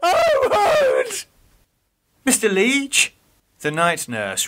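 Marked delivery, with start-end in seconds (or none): 0.0-1.3 high, crying shout of terror; Geoffrey wakes from a nightmare, screaming; terrified scream. Acted. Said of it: "awoke to the sound of his own screaming". 2.2-3.1 higher, worried call; concerned nurse. Acted. Nurse calls out.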